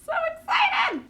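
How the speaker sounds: very high, drawn-out squeal; excited squeal. Real, not acted. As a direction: squeal slowly with bursting anticipation. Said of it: "I'm really excited for it".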